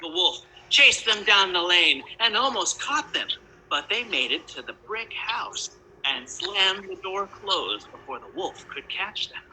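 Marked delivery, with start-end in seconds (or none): none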